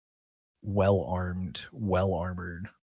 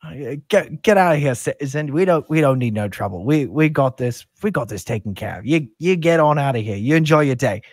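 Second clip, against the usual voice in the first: loud, raised voice, pushy; brusque guard; 5 semitones higher, 8 dB louder than usual. Acted. Guard sending a citizen away from trouble, loud and brusque. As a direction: order the stranger to move along. Guard sends off an interfering stranger.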